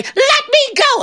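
very high, squealed plea; panicked plea. Acted. Trapped devil begs John for release, panicked. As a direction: cry out desperately to be released.

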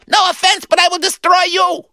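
very high, pinched menace; high squeaky villain. Acted. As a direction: squeak out the threat. Acting out scenes again.

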